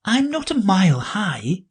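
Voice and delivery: put-on high girlish voice; Alice, high and polite. Alice protests.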